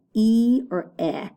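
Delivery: long "E" as ee, then short "eh"; contrasted vowels. Shown as a demonstration. Teacher quizzing pupils on the vowel, "E" versus "eh". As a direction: set "E" sharply against "eh" as two choices. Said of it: "that sound of the vowel".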